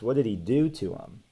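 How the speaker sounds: ordinary delivery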